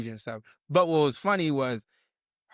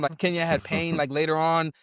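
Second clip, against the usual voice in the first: ordinary talk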